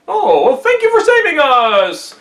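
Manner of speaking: high, slow, hammed-up thanks; grateful emperor. A narrator's reading. Emperor thanking Monkey for saving them, high gushing gratitude.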